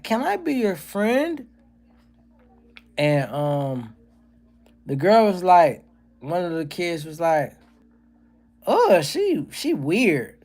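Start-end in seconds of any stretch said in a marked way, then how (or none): 0.0-1.5 put-on very high, slow, happy child voice; jubilant little kid. Daughter's line again, kid voice. 8.7-10.4 put-on high, dismissive child voice; mild mock kid saying she's weird; snooty little kid. Voicing a kid rejecting daughter.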